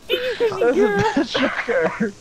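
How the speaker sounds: words squeezed out through laughter; laughing. Real, not acted. Repeating it while laughing hard.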